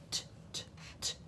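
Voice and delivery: very soft voiceless "t" taps; isolated T sound. Shown as a demonstration. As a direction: pairs of quiet "t" clicks, no vowel. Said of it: "starts with t".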